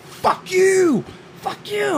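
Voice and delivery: high shrill-free mock road rage yell; mock furious yell. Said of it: "she's going".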